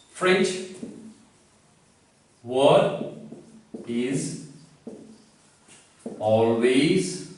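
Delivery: each word set apart with long pauses; slow dictated rule. Shown as a demonstration. Teacher to students, slow dictation word by word. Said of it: "So one of the exception is what?".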